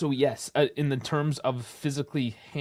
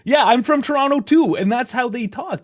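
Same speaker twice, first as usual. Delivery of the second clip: excited; 9 semitones higher, 6 dB louder than usual. Real, not acted. Laughing Toronto argument.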